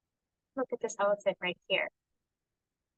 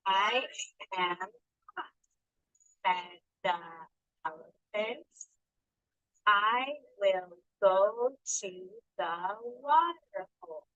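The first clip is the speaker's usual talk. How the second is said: put-on word by word, very slow, for children to follow; slow read-along; much slower than usual. Shown as a demonstration. Teacher reading the elephant's line aloud with kids, very slow. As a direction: sound out each word so the class can join. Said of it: "If you can read it with me".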